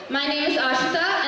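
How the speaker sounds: ordinary talk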